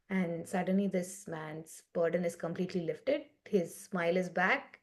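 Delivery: ordinary talk, nothing marked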